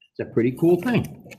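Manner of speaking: ordinary delivery, nothing marked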